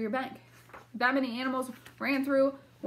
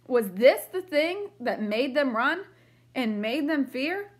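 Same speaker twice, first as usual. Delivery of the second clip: lively read-aloud storybook voice; reading to children; 4 dB louder than usual. A narrator's reading. Teacher reading a picture book to the class, lively. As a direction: read it brightly for young listeners.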